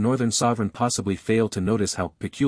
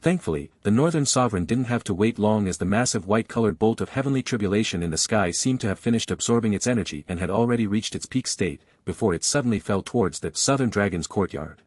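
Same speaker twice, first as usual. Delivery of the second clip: ordinary delivery, nothing marked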